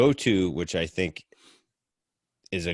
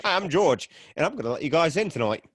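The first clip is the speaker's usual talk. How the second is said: higher-pitched attempt at an English accent; 6 semitones higher than usual. Friend quotes George who let them in, put-on English accent. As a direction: imitate a dubious English accent. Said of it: "an English accent".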